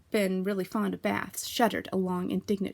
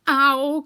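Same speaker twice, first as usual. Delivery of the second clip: loud, higher pained yelp; cry; 6 semitones higher, 10 dB louder than usual. A narrator's reading. Piglet scrubbed in the bath, loud yelp. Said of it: "cried Piglet".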